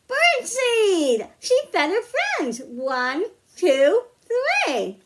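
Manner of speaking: high, loud, slow picture-book read-aloud; reading to children. Acted. Librarian reading a picture book to children at story time, slow and sing-song. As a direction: draw the kids into each page.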